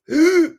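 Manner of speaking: very high, loud strangled gasp; tortured wheezing gasp. Acted. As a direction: gasp like your lungs are failing. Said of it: "inhale a tortured, wheezing breath".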